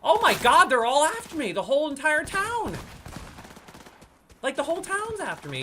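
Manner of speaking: loud, high-pitched panicky outcry; alarmed shouting. Real, not acted. Player finding the whole town after them, high alarmed shout. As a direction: yell it high, in disbelief at being swarmed.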